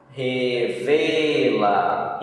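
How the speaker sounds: Revela stretched slowly, syllable by syllable; slow drawn-out word. Shown as a demonstration. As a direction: draw out Revela slowly as a classroom model. Teacher models a word slowly. (palavra alongada devagar)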